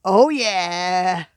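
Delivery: loud delighted exclamation; thrilled cry. Real, not acted. Beachcomber finding a marble, loud delighted cry. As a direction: shout it out with pure joy.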